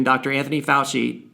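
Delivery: ordinary talk, nothing marked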